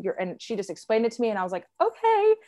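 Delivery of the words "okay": much higher, louder imitated okay; high-pitched okay. Mother in labor answering the nurse's explanation, high-pitched. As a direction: squeak out a retold okay.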